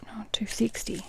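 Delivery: half-whispered tallying; soft whispered aside to herself; quiet muttering to self. Real, not acted. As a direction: work it out under your breath. Working out a sum to herself.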